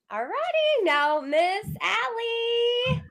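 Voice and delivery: stretched sing-song intro, high and slow; showman announcer. Pretend. Host bringing on the next guest, drawn-out announcer call. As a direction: roll out the guest's name like a game-show host.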